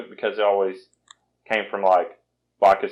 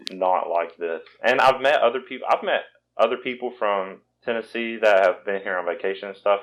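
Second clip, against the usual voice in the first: ordinary talk, nothing marked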